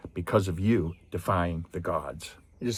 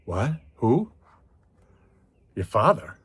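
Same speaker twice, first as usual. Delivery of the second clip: mock surprise; 5 semitones higher, 4 dB louder than usual. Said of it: "And Odysseus is like".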